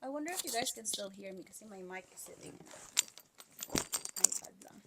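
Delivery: ordinary talk, nothing marked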